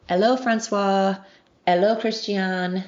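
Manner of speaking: American learner's French accent, slow greeting; terrible French accent. Shown as a demonstration. Reciting a memorized high school skit, butchered French accent. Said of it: "I know that my accent is terrible".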